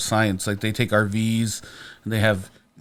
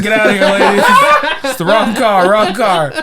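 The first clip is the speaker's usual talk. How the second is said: louder, higher, half-laughing shout; retold shooing yell; 7 semitones higher, 6 dB louder than usual. Telling the drunk woman she has the wrong car, laughing loud shout. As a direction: shoo her off, laughing at the absurdity.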